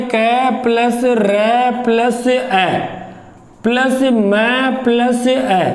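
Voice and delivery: slow, sound by sound spelling; slow breakdown. Shown as a demonstration. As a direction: spell out each sound slowly for the class. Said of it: "इसका टुकड़ा देखो". (धीरे धीरे एक एक ध्वनि गिनाते हुए)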